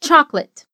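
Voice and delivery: Chocolate said as two syllables, CHOK-let. Shown as a demonstration. Teacher showing English Chocolate as CHOK-let. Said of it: "Repete comigo".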